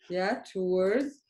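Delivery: normal talking voice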